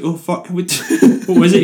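shocked laugh through the words; retold shocked laugh. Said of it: "And I was like".

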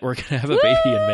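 high, loud, fast excited news. Real, not acted. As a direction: burst out with joyful news. Excited pregnancy announcement.

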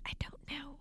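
breathy whispered reply. Retold or imitated. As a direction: whisper back, helpless. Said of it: "I'm like".

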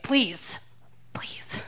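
whispered, fading please. Pretend. Shoplifter begging the manager in a fading whisper. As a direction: beg in a whisper.

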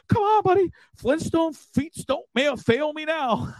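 very high, panicky self-urging; hammed-up frantic pep talk; mock terrified. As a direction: squeal a comic plea to your own feet. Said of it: "I was terrified".

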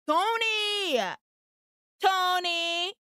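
mock far-off call. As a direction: shout the name down the street. Calling out from down the street.

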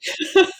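sudden high burst of laughter; embarrassed laugh. Real, not acted. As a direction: laugh at yourself, caught out. Laughing at own sarcastic tone.

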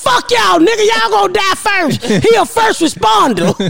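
high-pitched defiant comeback; retold arguing. Mother retells arguing with son's coworkers, indignant. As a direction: act out yelling back at hecklers. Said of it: "now I'm arguing with these motherfuckers".

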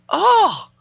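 high sudden "Oh!" burst out as pain hits; a sharp hammed-up yelp; mock cry of pain. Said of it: "and she goes".

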